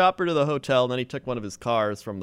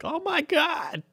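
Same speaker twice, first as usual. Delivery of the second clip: shocked squeal; slower than usual. Retold or imitated. Girls caught roommate, shocked.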